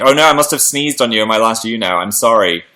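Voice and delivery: ordinary talk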